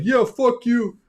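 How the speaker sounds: high-pitched pretend comeback; mock defiant retort. Voices Charlie answering the Pope, mock defiance. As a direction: fire back in mock defiance.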